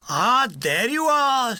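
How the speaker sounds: a hint of a sly sergeant major catching the men; slow, sly sergeant-major greeting; lightly voiced Welsh sergeant major. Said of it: "says a voice".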